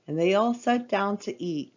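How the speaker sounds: usual speaking voice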